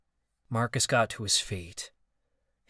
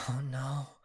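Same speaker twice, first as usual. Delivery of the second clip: soft hushed oh no; whisper; 2 semitones higher, 6 dB quieter than usual. Acted. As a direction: say it under the breath. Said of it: "Oberai whispered".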